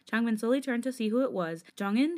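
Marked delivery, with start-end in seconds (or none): none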